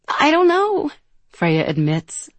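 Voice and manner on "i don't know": high, small admission; laughter gone, helplessly unsure; sobering up. A narrator's reading. Freya admits she has no plan, sobering up a little. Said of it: "sobering up a little".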